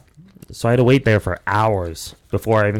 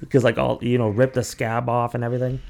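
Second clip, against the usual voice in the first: ordinary talk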